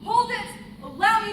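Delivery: loud barked halt; commanding shout. Acted. New inspector bursting in on the scene, loud halt. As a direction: stop everyone dead.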